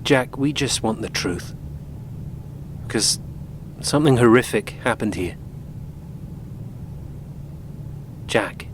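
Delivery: low, hushed appeal; quiet, grave and sincere; gentle urging. Acted. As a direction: coax softly, with long pauses. Gently urging reluctant son to confide.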